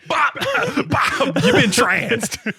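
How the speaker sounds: high, laughing Bop Bop; laughing mock announcement. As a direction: squeal it through laughter. Mock magic transformation, laughing.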